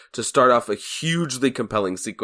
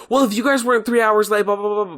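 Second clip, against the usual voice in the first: higher-pitched retold angry rant; mock lashing out; 10 semitones higher than usual. Host quoting Billy lashing out at his dad, mock angry. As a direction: rattle off an imitated outburst, pitched up. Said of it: "he lashes out".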